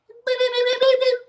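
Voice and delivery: very high, loud beeping calls; high-pitched beeps. Shown as a demonstration. Trainer calling a dog with high beeps. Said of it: "it may be just a high-pitched sound".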